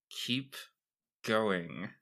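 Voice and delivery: low, slow monotone order; low deadpan command. Acted. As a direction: stiff robotic command. Bondar's frozen command.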